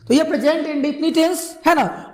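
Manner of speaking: ordinary talk, nothing marked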